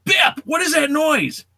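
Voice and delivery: high, slow shouted call across the room. Retold or imitated. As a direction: yell to pull her eyes off the TV. Calling sister away from TV.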